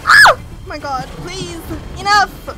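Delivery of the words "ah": piercing shriek of fright, much higher and louder; terrified scream. Real, not acted. Streamer screams as the machine attacks. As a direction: scream in real panic at the monster. Scared by enemy in game.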